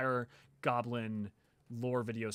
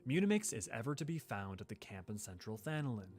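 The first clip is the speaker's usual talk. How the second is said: soft, lightly voiced noble lady; a gentle, measured quest-giver; Minfilia voice; 4 semitones lower, 6 dB quieter than usual. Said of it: "Love your Minfilia voice".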